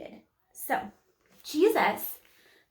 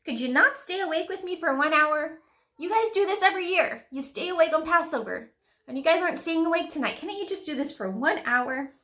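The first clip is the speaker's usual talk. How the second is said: higher-pitched, incredulous pleading; exasperated rebuke; 6 semitones higher than usual. Acted. Jesus finds his disciples asleep on Passover, acted exasperated rebuke.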